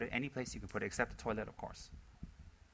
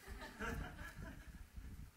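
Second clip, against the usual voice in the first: breathy laugh; 15 dB quieter than usual. Real, not acted. Laughs at guest.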